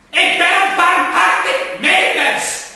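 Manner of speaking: high, loud, slow furious roar; bellowed. Acted. Gentleman bellowing his mercy at the plants, enraged. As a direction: hurl the claim at them like a threat. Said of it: "Hij brulde".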